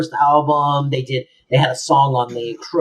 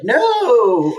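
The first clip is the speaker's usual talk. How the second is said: a hint of Cece's alarmed high no; sharp no; 9 semitones higher than usual. Cece retold, stopping Fran mid-sentence, mock alarmed. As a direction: cut her off in alarm. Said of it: "Cece turns around and she's like".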